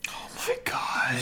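slow, very soft whispered exclamation; hushed whisper. Real, not acted. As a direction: whisper it in real disbelief. Stunned reaction to wig comparison.

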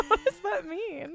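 high laughing question, slowed; laughing disbelief. Real, not acted. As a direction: laugh through the question, baffled.

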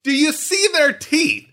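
exaggerated emphatic. Mock emphatic, high loud.

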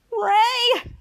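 loud, high call. Retold or imitated. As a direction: yell for your friend. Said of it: "Henry's like".

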